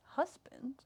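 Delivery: near-whispered, baffled echo; bewildered question. Retold or imitated. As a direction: ask it under your breath, taken aback. Acted shocked reply to him.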